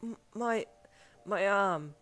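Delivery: hurt, broken reply; pained. A narrator's reading. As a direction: stammer it out through pain. Injured man answers in pain.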